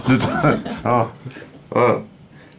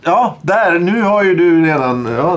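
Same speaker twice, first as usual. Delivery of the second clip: normal talking voice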